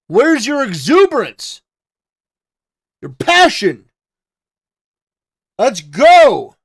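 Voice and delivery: loud, high, pumped-up cheerleading; hyped-up rant. Acted. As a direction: whip the crowd into enthusiasm.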